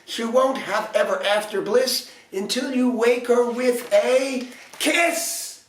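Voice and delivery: frantic fairies. A narrator's reading. Fairies round the prince, high frantic plea. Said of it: "feeling frantic".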